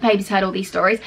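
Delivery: ordinary talk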